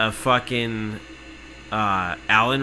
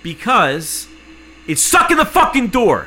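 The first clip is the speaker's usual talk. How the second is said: much higher and louder, "stuck" blasted out; furious shout; 10 semitones higher, 8 dB louder than usual. Retold or imitated. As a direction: explode on "it's stuck in the fucking door". Same words then shouted.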